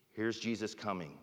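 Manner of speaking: ordinary talk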